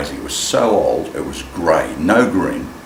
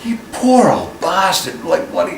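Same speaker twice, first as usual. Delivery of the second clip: sympathetic; 4 dB louder, slower than usual. Retold or imitated. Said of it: "And I went".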